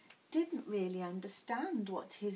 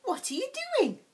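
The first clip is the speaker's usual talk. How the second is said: much higher and louder giraffe question; curious young giraffe; 8 dB louder, faster than usual. Acted. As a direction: call down to him with curiosity. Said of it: "a young giraffe peering down".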